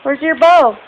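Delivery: high and loud, falling on bow; mock scolding. Real, not acted. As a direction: playfully demand where the bow went. Mother talking to her toddler.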